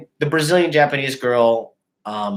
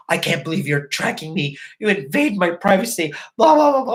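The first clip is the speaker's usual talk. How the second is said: higher whiny mocking voice climbing into blah blah; mock indignant girlfriend; 7 semitones higher than usual. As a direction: parody her flipping the script. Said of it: "she's like".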